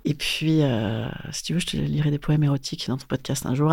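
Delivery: low raspy smoker's voice. Real, not acted. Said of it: "avec cette voix de fumeuse que j'ai aujourd'hui".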